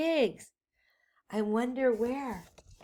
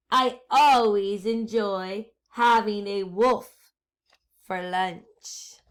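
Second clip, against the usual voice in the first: sinister witch; 2 semitones lower, 5 dB louder, slower than usual. Acted. Witch's sinister last line.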